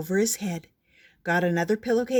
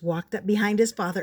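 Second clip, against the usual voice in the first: ordinary delivery, nothing marked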